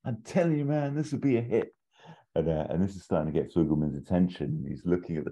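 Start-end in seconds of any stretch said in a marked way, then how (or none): 0.1-1.7 high, eager pitching voice; keen writer pitching to the boss. Retold or imitated. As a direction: sell the script hard, voice up high. Pitching film to producer.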